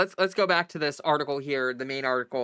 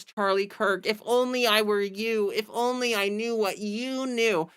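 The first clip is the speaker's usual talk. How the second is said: mock groveling Newsom; 6 semitones higher than usual. Mocking Newsom fawning over Charlie Kirk, simpering. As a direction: ham up a fawning suck-up.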